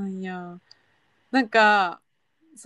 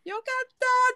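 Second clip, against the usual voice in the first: relieved joy; 13 semitones higher than usual. Retold or imitated. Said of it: "ってなんで".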